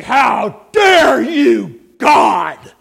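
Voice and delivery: the damned raging at God; furious defiance. Retold or imitated. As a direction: hurl it up at heaven. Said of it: "They'll look up and they'll say".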